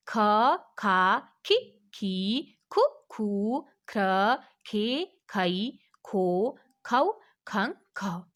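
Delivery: ख, खा, खि, खी said slowly, one syllable at a time; spelling out syllables. Shown as a demonstration. Teacher to pupils demonstrating the ख row, slow and clear. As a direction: sound out each ख syllable clearly for the class. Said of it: "कैसे?".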